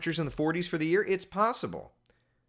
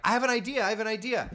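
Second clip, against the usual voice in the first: put-on eager child pitching an idea; 8 semitones higher, faster than usual. Kid pitching idea to parent.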